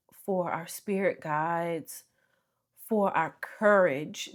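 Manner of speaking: ordinary delivery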